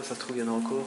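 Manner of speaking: ordinary delivery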